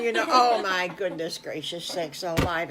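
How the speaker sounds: plain reading voice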